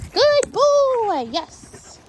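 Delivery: squeaky excited dog praise that fades to a breathy yes; high praise voice. Real, not acted. Praising dog after leave it.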